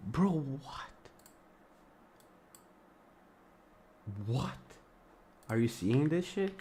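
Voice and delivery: can't believe what just happened on screen; shocked disbelief. Real, not acted. Asks chat about the vanished pairs.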